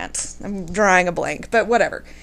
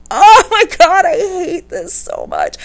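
high, laughing groan; laughing exasperation; 8 semitones higher, 6 dB louder than usual. Real, not acted. Groaning to listeners about the awkward setup, laughing. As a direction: laugh through the frustration.

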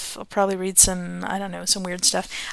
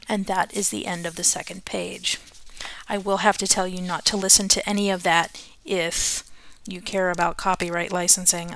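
plain reading voice

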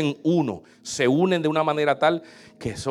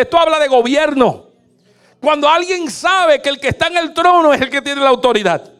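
higher and louder, shouted sermon; full-force preaching; 8 semitones higher, 6 dB louder than usual. Acted. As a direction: drive the point home to the congregation. (predicando a todo pulmón, agudo y fuerte)

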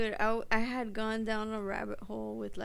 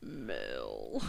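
very low, rising 'Male'; deep mock voice; 5 dB quieter than usual. Echoing the husband's demand for a son, voiced very low and teasing. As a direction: sink it to the bottom of the range, teasing. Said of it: "your accent makes everything sound so much more perverted".

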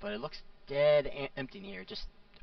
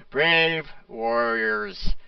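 slow, drawn-out booming greeting; 9 dB louder, much slower than usual. Acted. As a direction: stretch it out like an ancient spirit. Orc skull speaks again.

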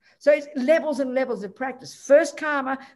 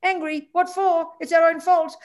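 a lightly voiced friend, higher and amused; casual, amused; 7 semitones higher, 4 dB louder than usual. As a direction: brush the anger off with a light, amused lift. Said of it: "he laughed very casually and he said".

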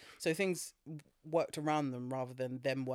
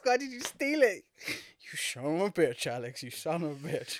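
movie villain line. Retold or imitated. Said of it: "We did Darth Vader off".

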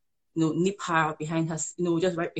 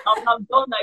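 laughing; 5 semitones higher, 8 dB louder than usual. Real, not acted. Amused confession after host's question.

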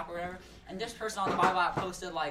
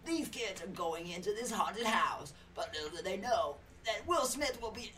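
slow, dramatic trailer announcer; 2000s movie trailer voice; 3 semitones higher than usual. Acted. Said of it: "like a two thousands voice".

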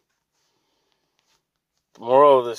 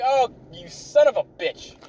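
mock fury; 8 semitones higher than usual. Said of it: "I'm furious at this point".

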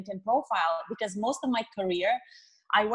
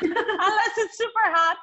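laughing through the words, pitched up; 11 semitones higher, 6 dB louder than usual. Real, not acted. Guest laughing to host about the heat, laughing. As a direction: break into a laugh mid-phrase.

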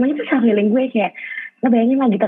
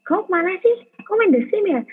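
much higher mock boyfriend suspicious question; imitating boyfriend's accusation; 11 semitones higher than usual. Boyfriend accusing her of playing The Sims, much higher mock voice. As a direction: lightly act out the jealous boyfriend. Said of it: "disangkanya cowok gue".

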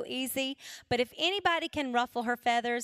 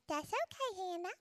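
put-on high, soft small-boy voice; tiny high toddler voice; tiny little voice; 6 semitones higher, 8 dB quieter than usual. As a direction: squeak it softly like a little boy. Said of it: "he used to have this tiny little voice".

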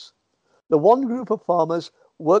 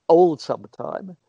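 ordinary talk, nothing marked

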